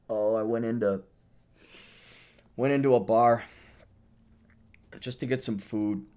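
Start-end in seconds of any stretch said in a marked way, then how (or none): none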